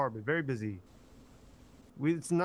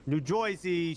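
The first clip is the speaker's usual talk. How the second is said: thick "Joisey" accent on Jersey; old-school New Jersey accent; slower than usual. Shown as a demonstration. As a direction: say Jersey as "Joy-zee", New Jersey style. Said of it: "talking about my accent".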